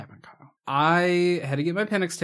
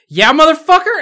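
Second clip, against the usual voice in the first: much higher, louder shout; mock triumphant shout; 14 semitones higher, 14 dB louder than usual. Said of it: "I'm like".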